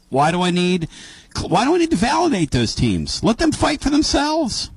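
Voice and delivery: high-pitched, insistent objection; exasperated protest. Real, not acted. Host argues against adding teams to the league, exasperated protest. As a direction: protest with pitch climbing sharply at the end.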